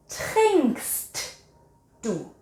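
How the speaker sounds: normal talking voice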